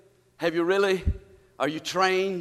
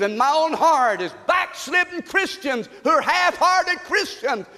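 fiery preaching; 5 semitones higher than usual. Acted. Preacher shouting at half-hearted Christians, fiery. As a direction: thunder it at the congregation.

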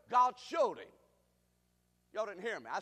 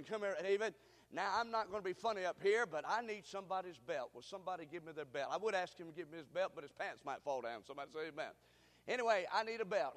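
ordinary delivery

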